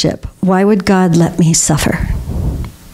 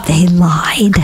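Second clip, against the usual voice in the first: whispered aside. Real, not acted. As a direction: drop to a breathy whisper for the punchline. Conspiratorial aside to audience.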